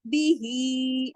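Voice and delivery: बी ही slow, ही held long; tajweed recitation. Shown as a demonstration. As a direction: stretch the ही. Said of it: "खड़ी जेर भी है और मद भी है". (बी ही में ही को पूरा खींचकर)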